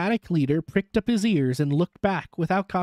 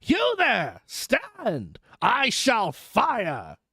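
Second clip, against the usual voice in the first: high, drawn-out warning shout; roared challenge; 6 semitones higher, much slower than usual. A narrator's reading. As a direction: challenge a stranger from afar. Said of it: "sang out as loud as he could roar".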